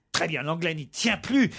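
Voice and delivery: normal talking voice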